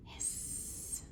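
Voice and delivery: unvoiced hiss of fire; hissing flames. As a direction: let the air hiss out like flames. Flames from dragon's nostrils.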